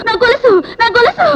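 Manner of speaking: frantic cry. Acted. Crying out over stolen chain.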